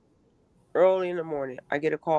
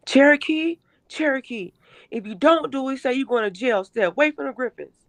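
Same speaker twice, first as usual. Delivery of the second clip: higher voice quoting a threat; imitating her daughter; 7 semitones higher, 3 dB louder than usual. Mother voicing her daughter repeating the nurses' threat, higher. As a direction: say it up high, like a scared girl repeating a warning.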